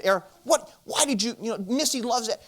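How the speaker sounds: ripping into him. Retold or imitated. Dad confronting neighbor boy over the snowman, ripping into him. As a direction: act out scolding a kid, stumbling over the words. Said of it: "I started ripping this young man".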